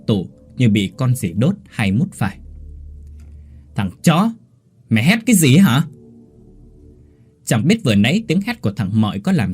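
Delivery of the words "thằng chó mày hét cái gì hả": higher, louder snarl of annoyance; angry yell. Acted. Young master yells angrily at the screaming servant boy. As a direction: bark at him for the racket.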